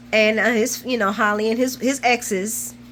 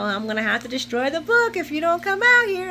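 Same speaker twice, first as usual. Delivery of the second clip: higher-pitched playful threat; teasing imitation of a threat; mock threat; 7 semitones higher than usual. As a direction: act out his threat, pitched up. Acting out his threat in retelling.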